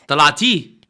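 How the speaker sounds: ordinary talk, nothing marked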